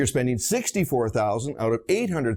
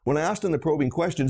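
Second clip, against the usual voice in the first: ordinary talk, nothing marked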